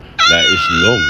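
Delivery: very high, drawn-out squeal; high exasperated outburst. Acted. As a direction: burst out slowly in a squeaky pitch. Breaks out of low voice.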